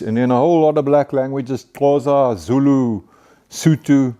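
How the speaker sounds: normal talking voice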